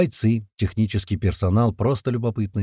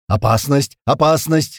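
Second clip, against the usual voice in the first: loud, repeated alarm; rattled-off warning; 4 semitones higher, 6 dB louder, slower than usual. A narrator's reading. Poluket warning of danger, rattling it off. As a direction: blurt the warning out urgently. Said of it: "затараторил Полукет".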